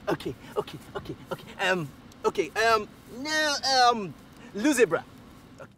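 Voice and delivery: ordinary talk, nothing marked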